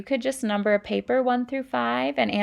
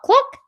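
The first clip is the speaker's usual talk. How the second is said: hen cluck; 9 dB louder than usual. Acted. Teacher acting the hens for the class, high cluck.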